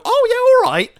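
high, loud fake approval; sarcastic mock agreement. Refusing to excuse history, sarcastic high mock agreement. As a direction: pretend to shrug it off.

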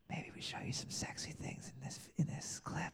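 hushed, teasing trailer whisper; very soft, conspiratorial come-on; seductive whisper. Acted. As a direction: whisper it like a naughty secret. Red band screen teasing sexy content.

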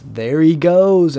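loud, slow, stretched out on goes; drawn-out loud call. Retold or imitated. Family friend announcing grandpa's fall, drawn-out loud call. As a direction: call it out slow like watching him topple. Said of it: "and he goes".

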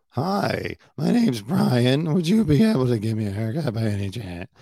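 hammed-up impression of a polite caller; Brian. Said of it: "the way his voice goes up when he's asking".

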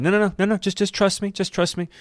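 high, pleading voice of Josh; Josh reassuring. Retold or imitated.

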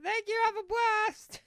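high-pitched mock sign-off; mimicking band sign-off. Cohost echoes the band's goodbye in a high mimicking voice.

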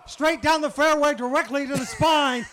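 loud, slow golf-style call; excited call. Real, not acted. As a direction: boom it like a golf shot.

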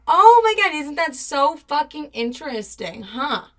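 exaggerated fake amazement; sarcastic mock shock. Reacting to the boyfriend's lies with loud sarcastic outrage.

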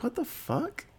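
high-pitched imitated bafflement; mock-incredulous, voice jumping up; baffled reaction. Confused student reacting to being told to leave, imitated bafflement. Retold startled reaction to eviction.

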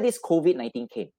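usual speaking voice

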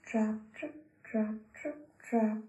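usual speaking voice

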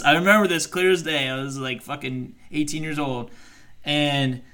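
ordinary talk, nothing marked

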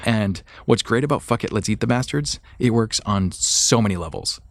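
normal talking voice

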